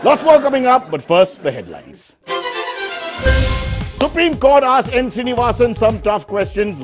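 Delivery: punchy headline read over music; parody news anchor. Acted.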